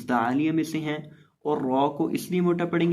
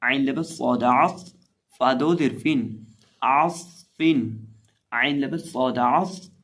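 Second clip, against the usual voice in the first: letter-by-letter teacher spelling, slow and loud; slow spelling drill; 4 dB louder, much slower than usual. Shown as a demonstration. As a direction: drill it out for students. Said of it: "اب اس لفظ کے اندر".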